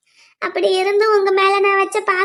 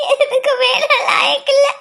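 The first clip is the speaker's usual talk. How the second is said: crying out her worthlessness; 5 semitones higher than usual. Acted. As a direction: voice breaking into tears. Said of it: "baby, அழாத baby". (அழுகையுடன்)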